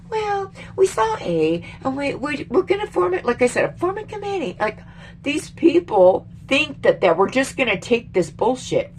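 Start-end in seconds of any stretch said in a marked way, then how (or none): 0.1-3.3 high, halting mock politician voice; mock evasive politician. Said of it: "You don't be like". 3.8-4.6 high, soft mock politician voice; mock evasive politician. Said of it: "You don't be like".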